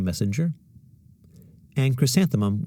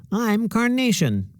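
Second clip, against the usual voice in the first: much higher, slow and proud; eager classmate; 13 semitones higher, slower than usual. A narrator's reading. Classmate claiming a flower name, eager. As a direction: show off the new name.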